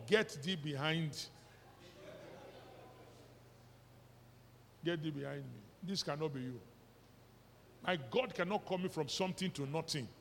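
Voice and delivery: a sulky believer's pious refusal; mocking a believer. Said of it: "Is that not how we say it now?".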